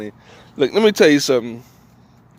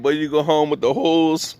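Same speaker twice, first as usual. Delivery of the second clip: ordinary talk, nothing marked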